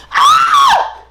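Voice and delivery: very high loud yelp; startled shriek. Retold or imitated. Mermaid caught in the shower retelling her scream. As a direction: shriek in surprise. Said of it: "I'm like".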